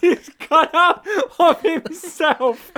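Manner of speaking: high, loud and slowed by laughter; squeezing words out between laughs; laughing through words. Real, not acted. Podcaster pointing out a silly cutout to friends, laughing hard.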